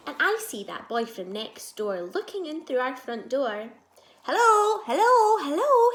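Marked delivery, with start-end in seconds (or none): 4.2-5.9 high, loud repeated hello shout. Acted. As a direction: call out hello loudly over and over. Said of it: "he shouts".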